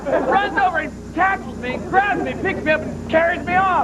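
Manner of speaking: ordinary talk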